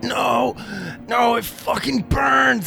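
high-pitched screamed agony, acted; George Piston screaming. Man burning inside a giant creature screams out, high and agonised. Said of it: "George Piston starts screaming out".

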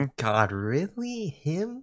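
high, hushed, sneering disbelief; mock disdain. Friends mocking who hosts the podcast, scoffing disbelief. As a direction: imitate people scoffing at the host. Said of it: "and they were like".

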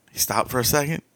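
ordinary delivery

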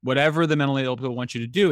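usual speaking voice

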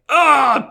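high, loud wail of dismay; anguished cry. Real, not acted. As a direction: let out a pained wail. Despair over character deaths.

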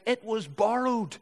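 high, slow lament; read-aloud cry of distress; dismayed cry. A narrator's reading. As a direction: cry it out in dismay, stretching the words. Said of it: "he cried out".

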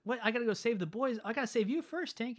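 put-on the worried boy hero rushing to save Tink; Peter's urgent cry. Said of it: "And then he's like".

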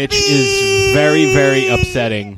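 mock sob. Mock grief at dog's death.

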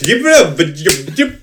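high, nonsense yelps; mock typing yips. Host imitating a wrestler typing, high yipping noises. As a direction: yip like a clumsy typist. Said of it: "Goldberg typed".

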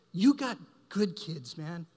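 slow, lightly voiced pastor; mild caring rebuke. Pastor telling a venting father his kids are good, slow and caring. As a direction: set him straight, gently. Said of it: "He goes".

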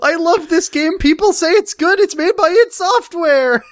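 put-on high loud kid voice; character voice. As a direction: voice the brother high. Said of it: "Jacob voiced in story".

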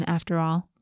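usual speaking voice

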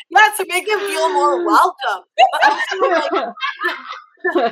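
talking through big laughs; laughing hard while speaking. Real, not acted. Laughing after the accent joke.

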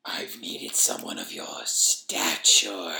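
very slow, low whispered croak; crackling creature voice. A narrator's reading. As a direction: drag out each word through a clogged throat. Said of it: "in a crackling tone".

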